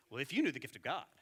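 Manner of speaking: snide, superior reading; condescending tone. Shown as a demonstration. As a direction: sneer it like a put-down. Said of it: "with this tone of voice".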